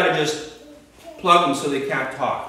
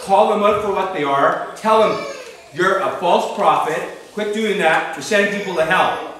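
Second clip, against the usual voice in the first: raised, louder preacher's rebuke; stern public denunciation, acted out; sharp rebuke; 4 semitones higher, 4 dB louder than usual. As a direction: call them out firmly and forcefully. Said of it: "Rebuke them sharply".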